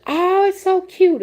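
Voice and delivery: very high, loud and slow, squealing over something adorable; gushing delight. Retold or imitated. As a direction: coo like you just saw the cutest thing. I was like, gushing over gnome.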